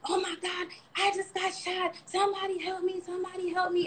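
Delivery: imitating a panicked man in a high voice; high-pitched retold plea for help, not shouted; mock frantic cries at ordinary volume; hallucinating victim. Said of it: "he's hallucinating".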